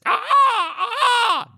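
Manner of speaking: shrill high squawking bird cries; imitated bird calls. Showing friends what birds sound like, high bird squawks. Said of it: "birds sound like this".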